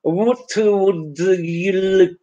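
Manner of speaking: vowels swapped, consonants kept: "what would you look"; consonants only. Shown as a demonstration. Teacher showing consonants carry the meaning. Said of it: "if I pronounce the consonants only".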